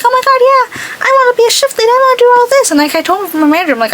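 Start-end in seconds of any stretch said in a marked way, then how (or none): 0.0-2.7 high, loud mock-eager gush; mock eager kid. Mocking the kid who wants to be shift lead, hammed-up eagerness. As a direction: mimic the kid's overeager bragging.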